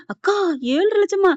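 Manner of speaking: high-pitched mock amazement; astonished. Henchman astonished at the huge sum. (வியப்பில் உயர்ந்த குரலில் கேள்வி)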